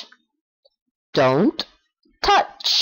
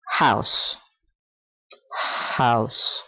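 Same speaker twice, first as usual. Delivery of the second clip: house said low and breathy, fading out; soft ending; 6 semitones lower, 3 dB quieter than usual. Shown as a demonstration. Teacher showing the learner how to soften house. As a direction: let the word house fade to a soft breath. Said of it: "Suaviza aquí".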